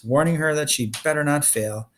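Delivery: ordinary delivery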